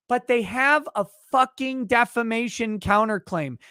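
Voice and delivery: usual speaking voice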